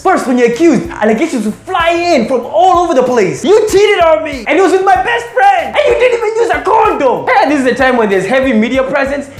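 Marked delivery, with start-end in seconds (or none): 3.4-7.3 rising high angry accusation; accusing partner. Pretend. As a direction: build it up into a shout.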